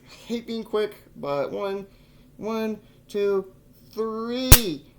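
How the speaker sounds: tense, rising, bracing count; nervous countdown. Real, not acted. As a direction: brace yourself and count down. Counting before needle insertion.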